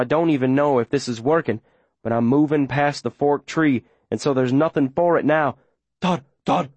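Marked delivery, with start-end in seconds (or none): 6.0-6.7 yappy barked name; dog barking a name. Acted. Dog barking to lure the man away, yappy. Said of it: "My dog barking my name".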